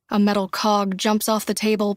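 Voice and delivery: ordinary delivery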